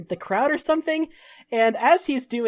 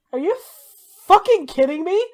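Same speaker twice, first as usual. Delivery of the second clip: sharp rise on fucking, louder, incredulous; angry disbelief; 8 semitones higher, 5 dB louder than usual. Acted. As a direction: snap it at the prisoners in disbelief. Slaver finds carts missing.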